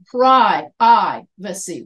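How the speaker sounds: PRI-I-VACY in slow, loud pieces, long "eye" vowel; American pronunciation broken down. Shown as a demonstration. Teacher breaking down the American word, loud long-i PRI-VACY. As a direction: punch "PRY", draw out the "eye", then "vacy". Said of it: "we put the accent on the pr".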